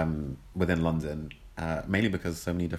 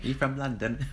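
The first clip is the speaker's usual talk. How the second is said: put-on higher-pitched teasing London accent; mock London accent; 7 semitones higher, slower than usual. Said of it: "You sound like you're a bit from London".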